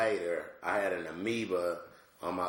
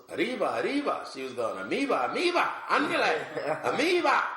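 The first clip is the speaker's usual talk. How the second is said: much higher and louder, squeaky cartoon mouse cheer; 11 semitones higher, 6 dB louder than usual. Dad imitating his seven-year-old's cartoon mouse chant, high and loud. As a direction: belt it out like the fast cartoon mouse, climbing higher. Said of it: "the mouse from the cartoons".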